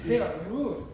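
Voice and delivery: loud, amused exclamation; joking complaint. Real, not acted. Teacher recalling a trip to France, loud joking complaint. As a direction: blurt it out loudly after a laugh.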